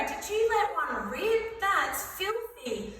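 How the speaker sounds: high, drawn-out mock outrage; disgusted accusation. Boy to his bro on the bus, disgusted accusation. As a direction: accuse a mate, slow and disgusted. Boys accuse friend of farting.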